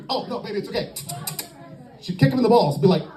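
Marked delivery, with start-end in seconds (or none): 0.1-0.9 soft, higher-pitched cooing reassurance; mock soothing. Woman comforting the man she just hit, mock soothing. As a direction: coo it softly, imitating a comforting girlfriend.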